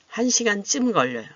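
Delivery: model answer. Shown as a demonstration. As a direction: call out the answer clearly. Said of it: "답을 어떻게 하셨어요?".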